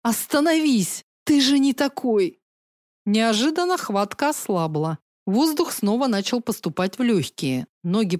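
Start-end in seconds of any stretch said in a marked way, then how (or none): 0.0-2.4 desperate plea. Acted. Choked sister begs brother to stop.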